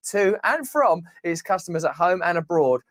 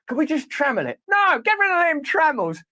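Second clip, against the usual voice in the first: mock outrage; 10 semitones higher than usual. Mock pleading over trammels.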